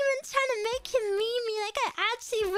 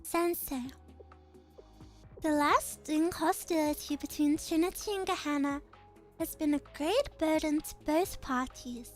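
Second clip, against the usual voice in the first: lower, measured and calm; composed noble girl; 6 semitones lower, 3 dB quieter than usual. Acted. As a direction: speak with quiet authority. Same character voice continues.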